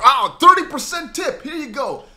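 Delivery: loud, high mock enthusiasm; delighted tipper. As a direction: act out an over-impressed big tipper.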